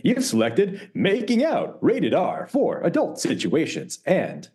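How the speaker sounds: slow, high automated phone announcer; Moviefone announcer. Retold or imitated. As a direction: read it out like a movie phone line. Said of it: "I'm talking about movie phone".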